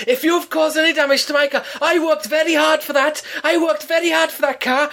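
high indignant mock voice of an older man; pompous neighbour. Neighbour lectures her at the door, high and pompous. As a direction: puff up like an officious ex-copper.

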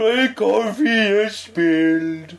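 high, slow whimpering giant; big giant voice. Pretend. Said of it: "That was my big giant voice".

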